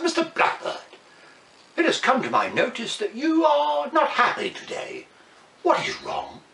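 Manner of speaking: high, thin royal ant voice; a tiny queen asking with concern. Acted. Ant queen questioning the blackbird, in a high small voice.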